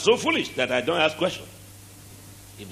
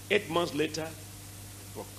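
ordinary delivery

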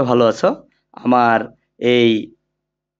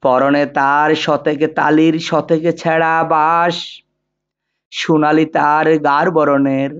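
poem recitation. Shown as a demonstration. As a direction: recite it line by line, sustained and rhythmic, with long pauses between couplets. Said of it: "এখন কবিতাটি আবৃত্তি করছি".

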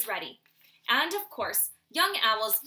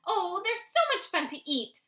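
excited exclaim; 8 semitones higher, 5 dB louder than usual. Acted. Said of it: "Soren exclaimed".

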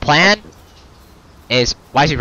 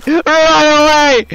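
panicked; 13 semitones higher, 7 dB louder than usual. Real, not acted. Fleeing a monster.

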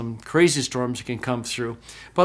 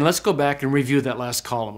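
ordinary delivery

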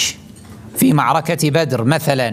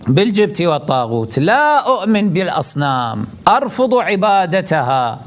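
a higher, pleading put-on voice of a believer dodging confrontation; mock believer's excuse; 6 semitones higher than usual. Preacher voicing a believer who refuses confrontation, lightly mocking. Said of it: "يقول".

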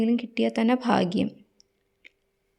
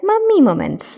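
announcer call; 5 semitones higher, 8 dB louder, much slower than usual. Acted. Channel intro call.

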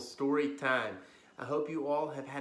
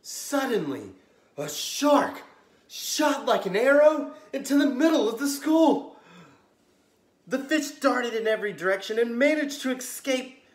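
dramatic suspense; 10 semitones higher, 14 dB louder than usual. A narrator's reading. Dramatic shark arrival.

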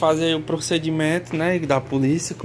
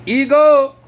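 loud, much higher yell of Igor; shout; 11 semitones higher, 10 dB louder than usual. Real, not acted. Owner yelling the nephew's name to make the dog call. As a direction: holler the name across the house.

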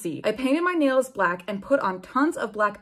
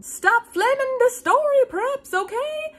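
whiny author's note; 4 dB louder, slower than usual. Acted.

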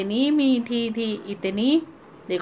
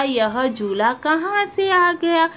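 squirrel wondering; 6 semitones higher, slower than usual. Acted. Teacher voices squirrel's wonder.

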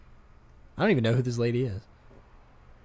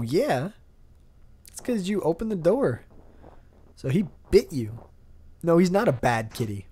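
cooing at cat; 7 semitones higher, 6 dB louder than usual. Real, not acted. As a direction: gush over the kitty like a pet owner.

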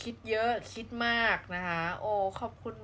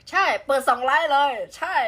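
excited shout; 6 semitones higher, 13 dB louder than usual. Real, not acted. Excited reply to chat.

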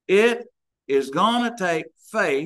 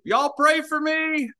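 put-on high, slow, pleading child's cry; mock child scream; 11 semitones higher, slower than usual. Little boy dragged out of church, wailing high and slow. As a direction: wail it like a little boy being carried out. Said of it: "he screamed out".

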